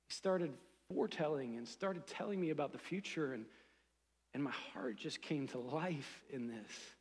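normal talking voice

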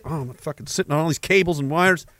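normal talking voice

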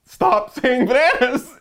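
high, loud yell at someone off-mic; startled yell. Real, not acted. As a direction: yell out for the prankster to stop. Yelling at whisperer behind the door.

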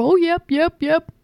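high, mock-cheerful voice; brainwashed believer. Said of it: "over and over".

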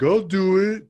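loud, high, gung-ho outburst; eager volunteer. Retold or imitated. Vic volunteers for the scaffold spot, eagerly. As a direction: jump in keenly, pitch shooting up.

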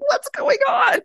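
sudden high-pitched "what's going on?" cry, half laughing; baffled exclaim. Retold or imitated. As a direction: jump way up in pitch in mock alarm. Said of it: "It was just like".

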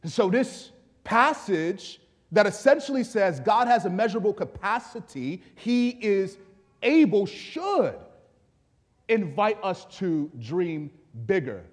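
emphatic pulpit delivery; forceful preaching. Real, not acted. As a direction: preach it, stretching the final words.

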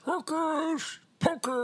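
spooky voice. Pretend. Spooky title intonation.

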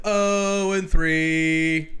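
loud, slow, drawn-out heckle; taunting call-back. Retold or imitated. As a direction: stretch it into a stadium taunt. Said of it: "I would call back with".

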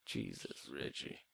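very low, hushed voice; calm movie character. Retold or imitated. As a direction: murmur it low and barely voiced. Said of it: "such a calm demeanor throughout the whole movie".

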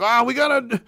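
mock panicked voice. Mocking critics calling protesters terrorists, high mock panic. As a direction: cry it out like a hysteric. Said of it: "no one's like".

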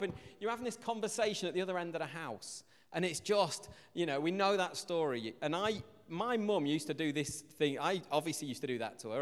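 ordinary talk

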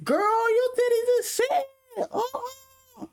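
very high-pitched mock girly squeal; gushing girl impression. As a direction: squeal like a girl hyping up her friend. Imitating girls hyping each other up.

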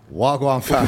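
joking farmer using London street slang; lightly hammed-up rural "wagwan"; comic farmer greeting; imagined Yarmouth farmer. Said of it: "like, some farmer in fucking Yarmouth".

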